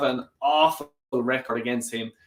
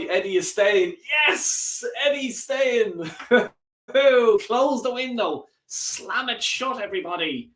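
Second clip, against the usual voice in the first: high, drawn-out jubilant calls; elated celebration; 8 semitones higher than usual. Real, not acted. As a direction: celebrate with long, stretched words and a laugh. Celebrates Eddie staying, calling out.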